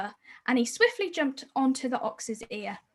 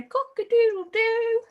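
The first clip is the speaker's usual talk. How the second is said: high loud rooster crow; 10 semitones higher, 4 dB louder than usual. Shown as a demonstration. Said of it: "we say".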